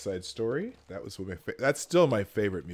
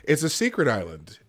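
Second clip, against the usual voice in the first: usual speaking voice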